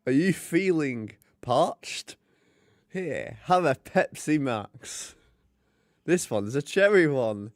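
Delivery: mock advert pitch for a cherry Pepsi Max; Pepsi advert read. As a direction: sell the drink like a cheesy TV ad. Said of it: "my audition for the, my advert".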